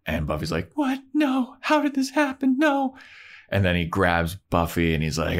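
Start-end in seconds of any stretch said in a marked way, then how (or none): 0.7-3.0 very high, put-on panicked squeal; hammed-up horrified disbelief, pitched way up; mock distress. Buffy shocked Angelus is back.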